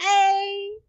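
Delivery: A said high, short and pointed; mock Canadian eh. Mother catching her kids saying eh, high. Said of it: "I was like".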